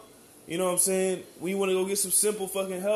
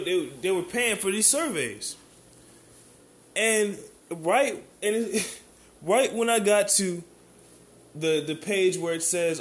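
ordinary delivery

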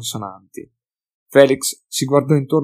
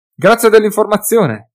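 higher, louder, dry mock gratitude; sarcastic thanks; 10 semitones higher, 10 dB louder than usual. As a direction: thank him with biting irony. Felix answers sarcastically.

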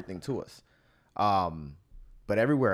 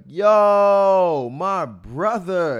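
much higher, louder, slow put-on hustler call; street hustler greeting; 10 semitones higher, 14 dB louder, much slower than usual. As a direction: ham up a street seller hailing a brother. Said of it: "will see you and be like".

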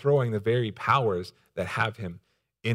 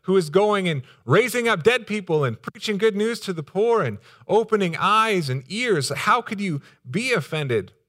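higher, louder voice of baffled disbelief; incredulous reaction; 9 semitones higher, 5 dB louder than usual. Acted. Preacher voicing readers puzzled at the offence, incredulous. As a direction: protest in disbelief, pitched up and loud. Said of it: "we read that first and we're like".

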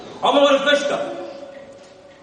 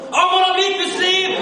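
excited shout; 9 semitones higher than usual. Retold or imitated. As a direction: blurt it out to the whole street. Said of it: "han skriker".

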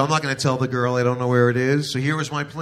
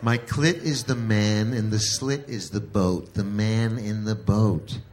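slow, lower, spelling it out; slow patient explaining; 3 semitones lower, slower than usual. Acted. Wife explaining to her husband, slow and patient. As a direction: explain it like to a child.

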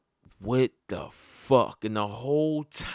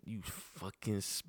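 much softer, a bitten-back curse; under his breath; 5 semitones lower, 13 dB quieter than usual. Retold or imitated. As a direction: swallow the insult before it gets out. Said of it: "I just wanna say".

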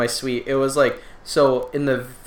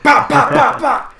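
loud high popping sounds; imitated pops; 13 semitones higher, 8 dB louder, slower than usual. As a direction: pop them out like gunshots. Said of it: "It's like a gunshot".

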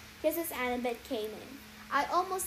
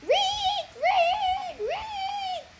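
high, shrill rodent squeaks; terrified guinea pig squeals; 5 dB louder, much slower than usual. A narrator's reading. Guinea pig Percy seeing himself in the mirror, terrified squeals. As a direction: squeal in pure terror. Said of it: "made me scream in terror".

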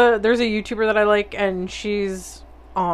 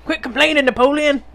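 lightly voiced scolding grandma, higher pitched; grandma in Napoleon Dynamite; 5 semitones higher than usual. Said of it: "be like the grandma in Napoleon Dynamite".

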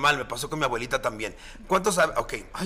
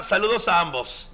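usual speaking voice

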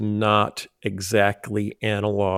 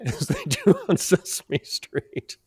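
laughing. Real, not acted. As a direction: chuckle through the line. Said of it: "laughs while saying".

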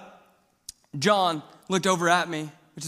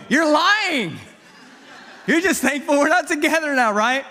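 laughing retort; 6 semitones higher, 4 dB louder than usual. Retold or imitated. Teasing retort to girlfriend's dad.

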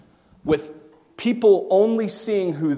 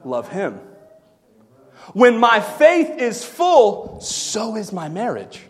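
ordinary talk

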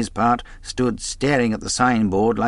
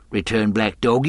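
plain reading voice

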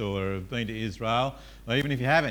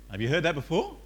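normal talking voice

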